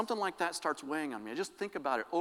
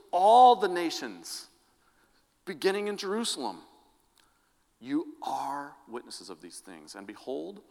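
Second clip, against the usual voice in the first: rising, stirring Bible reading that builds to louder wonder; scripture reading; 3 semitones higher, 6 dB louder than usual. Acted. Pastor reading the ascension to the congregation, solemn then swelling. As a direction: proclaim the passage like it's happening now. Reads Luke's ascension passage aloud.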